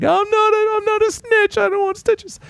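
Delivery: put-on very high squeaky kid voice; mock a little boy's panicked plea; imitated protesting child. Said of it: "maybe he's just like".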